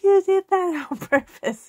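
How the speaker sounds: indignant kid's wail, played for laughs; mock accusation. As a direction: accuse like a wronged little brother, half laughing. Retold brother accusing dad after spray.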